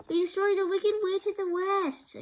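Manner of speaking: high, loud proud announcement; excited cry. Acted. Dorothy announces to Oz she killed the witch, crying out high and loud. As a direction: burst out with the good news. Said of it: "cried Dorothy".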